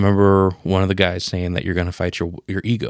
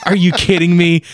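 high, loud, incredulous; stunned disbelief; 10 semitones higher, 7 dB louder than usual. Retold or imitated. Retelling the eerie lyrics, a high, loud stunned outburst. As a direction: blurt it out like you can't believe it.

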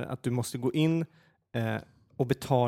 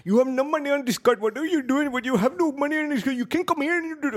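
much higher-pitched, imitated telling-off; mock scolding; 14 semitones higher, 4 dB louder than usual. Clerk telling off a customer over an empty card, mock high-pitched scolding. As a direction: scold in a put-on high voice, running on without letup. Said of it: "och börjar skälla ut henne".

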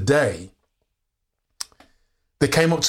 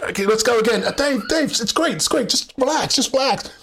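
high-pitched, placating director; hurried reassurance, pitched up; reassuring director; 8 semitones higher than usual. Retold or imitated. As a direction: imitate a director soothing a nervous actor. Acts out director reassuring him.

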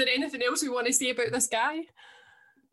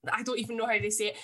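normal talking voice

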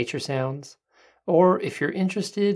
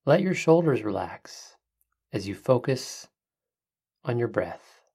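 soft spa whisper; 5 dB quieter than usual. Acted. Leads a breathing meditation.

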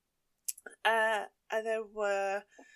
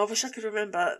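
ordinary talk, nothing marked

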